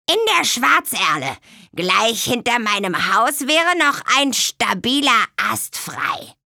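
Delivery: kindly hag asking; old swamp witch. A narrator's reading. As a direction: swamp witch aunt asks where she sleeps. Tante Müffel voiced.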